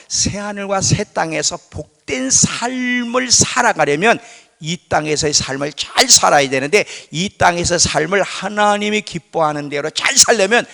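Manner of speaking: rising preacher's urgency; building sermon climax. Acted. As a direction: preach it up, pressing each phrase.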